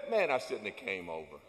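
morning-after regret. Retold or imitated. Churchgoer regretting last night, groggy and low. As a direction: sound like you just woke up sorry. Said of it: "you said".

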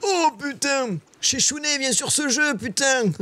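very high, squealed excitement; gleeful squeal. Real, not acted. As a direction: exult up in a high squeaky register. Exulting after a headshot.

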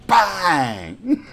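loud drawn-out mock bang; shouted gunshot. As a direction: shout it like a rifle crack. Imitates the sniper rifle crack.

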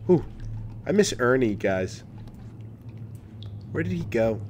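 ordinary talk, nothing marked

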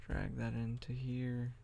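normal talking voice

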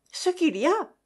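high indignant cry. A narrator's reading. Mother answers the friends, high indignant cry. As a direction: fling it back in outrage. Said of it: "cria madame de Staël".

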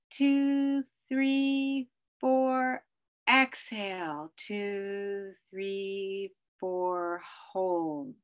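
calm counted breathing guide; slow breath counting. Shown as a demonstration. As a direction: count each breath slowly, fading out. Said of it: "I'm just gonna try that with you guys".

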